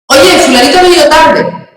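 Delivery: "Oye, fulanito ha venido tarde" with "ha" said high and loud; imitating a wrong pronunciation. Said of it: "oigo a niños de ocho años decir".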